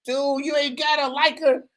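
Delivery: high falsetto imitation of buddies; incredulous friends. Imitating friends urging him to date her, high incredulous voice. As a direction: squeak it out as exasperated advice.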